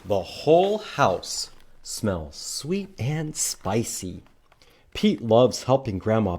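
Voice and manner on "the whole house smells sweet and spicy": ordinary talk, nothing marked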